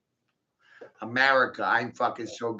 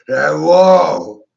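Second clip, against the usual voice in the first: excited whoa; 12 dB louder than usual. Pretend. Shark blood rush, mock frenzy.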